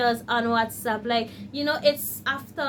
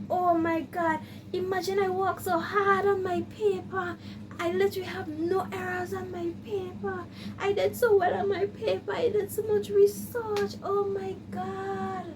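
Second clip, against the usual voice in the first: bawling; 7 semitones higher, 3 dB quieter than usual. Retold or imitated. Bawling over the missed deadline.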